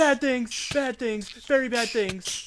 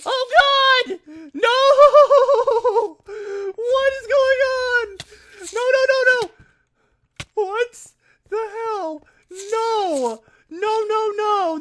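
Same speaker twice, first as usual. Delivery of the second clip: shrill screams of panic; screaming in high panic; panicked high yelling; 8 semitones higher, 6 dB louder than usual. Real, not acted. Panic as game goes wrong.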